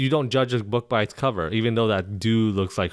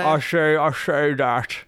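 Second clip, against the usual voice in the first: Sean Connery impression; 4 semitones higher, slower than usual. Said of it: "that sounds like Sean Connery".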